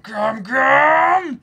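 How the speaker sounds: high, loud battle cry; attack call. Retold or imitated. Hyping up Luffy's attack, loud high battle cry. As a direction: mimic the anime attack call.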